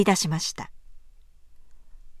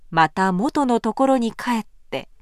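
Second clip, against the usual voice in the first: ordinary delivery, nothing marked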